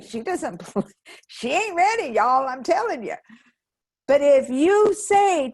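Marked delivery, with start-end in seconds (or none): 0.0-3.2 sassy nervous system. Pretend. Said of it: "your nervous system's like".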